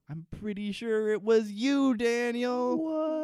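loud, high comic outburst; mock accusation. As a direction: build to a hammed-up accusing yell. Acted deathbed accusation of Daniel.

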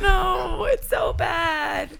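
high cry of embarrassment, then slow; laughing protest at herself; embarrassed laugh. Real, not acted. Embarrassed outburst.

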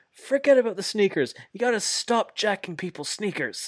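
high, hammed-up scolding; lightly voiced wrestler telling off; mock scolding. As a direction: scold a partner like a brawler. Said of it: "Moxley says".

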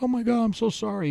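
apologetic. Retold or imitated. Driver to the boy he backed over, imitated apology. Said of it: "He was real apologetic".

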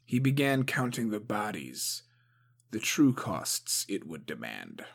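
usual speaking voice

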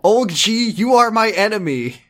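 high, loud playful fury; fake-rage at the author; mock outrage.

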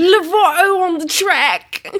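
high, loud, slow pop star voice. Pretend. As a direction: camp up a breathless celebrity.

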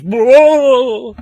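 sudden high, loud ghostly shout; jump-scare face yelling out; ghostly whoa. Retold or imitated. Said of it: "it goes".

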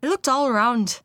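put-on rough Irish accent, measured reading; amateur Irish accent, narrating; attempted Irish accent. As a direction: carry on the tale in an Irish lilt. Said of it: "This is really rough".

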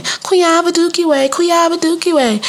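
slow, high-pitched teasing chant; mock the name like children, drawn out high; taunting kids. Classmates pointing and laughing at a girl, taunting. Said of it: "all the kids are saying".

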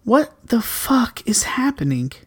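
hushed, slow whisper; whispered disbelief. Real, not acted. Disbelief over candy prices.

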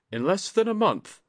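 high answer as Jiro; lightly voiced young samurai. A narrator's reading. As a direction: answer shyly as the young man.

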